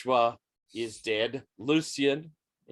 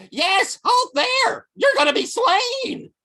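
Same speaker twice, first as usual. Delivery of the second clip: squeaky high voice; 7 dB louder than usual. Acted. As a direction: squeal it like a tiny creature. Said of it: "from underneath him, we hear".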